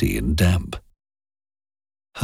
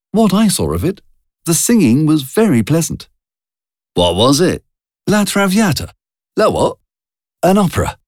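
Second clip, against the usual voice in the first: put-on a young woman's voice, pitched clearly higher; Jean's higher voice; 10 semitones higher, 3 dB louder than usual. A narrator's reading. Jean telling Charlie about the theatre, higher young voice. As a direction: voice Jean light and chatty, higher up.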